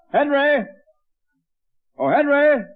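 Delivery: loud, high call up the stairs. Acted. Father calls his son from downstairs, loud. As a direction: call his name through the house.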